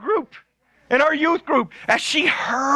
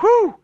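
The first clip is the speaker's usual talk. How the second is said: high, loud relieved whew; 9 semitones higher, 4 dB louder than usual. Retold or imitated. Jamie proud after scaring off cows, relieved whew. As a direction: blow out relief at getting rid of them.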